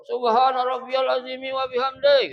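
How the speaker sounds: high, loud intoned praise; overdone loud recitation; exaggeratedly loud prayer. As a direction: blare the prayer to signal you're busy praying. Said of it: "kenceng dikencengin lagi".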